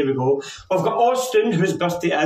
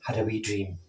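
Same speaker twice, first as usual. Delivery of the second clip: soft storytelling; 6 semitones lower, 8 dB quieter than usual. Acted. Tells children a story.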